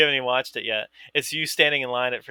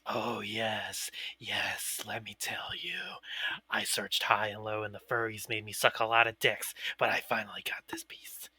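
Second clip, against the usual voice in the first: low, slow whisper, mock-dramatic; whispered confession; 4 semitones lower, 9 dB quieter than usual. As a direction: whisper it like a solemn, absurd confession. Acting out mock art buyer's reply.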